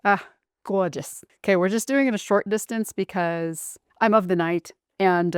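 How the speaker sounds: ordinary talk, nothing marked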